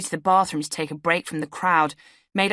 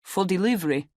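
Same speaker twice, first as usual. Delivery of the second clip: put-on Romanian accent; 2 semitones higher than usual. A narrator's reading. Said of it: "in a thick Romanian accent".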